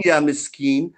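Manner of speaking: normal talking voice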